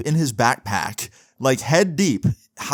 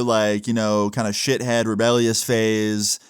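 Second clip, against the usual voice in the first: ordinary delivery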